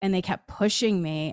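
ordinary delivery

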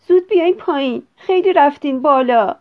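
loud, high, urgent mother's call. A narrator's reading. Mother calls children down from flight.

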